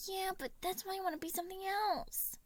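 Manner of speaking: put-on high, whiny pouting child. A narrator's reading. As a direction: sulk as you say it. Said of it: "Remus pouted".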